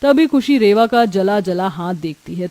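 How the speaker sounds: plain reading voice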